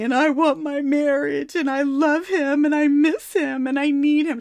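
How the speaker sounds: imitating a tearful longing; crying. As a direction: plead like a heartbroken wife. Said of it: "she'd be crying".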